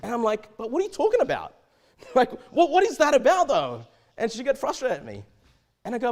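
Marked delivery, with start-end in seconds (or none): 0.6-3.8 high, louder, laughing disbelief; baffled question. Retold or imitated. Boss baffled by employee's half conversation, high incredulous question.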